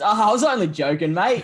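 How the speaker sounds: loud, high laughing retort; bursting out laughing. Retold or imitated. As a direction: imitate a man cracking up at his own joke. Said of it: "bursts out laughing and says".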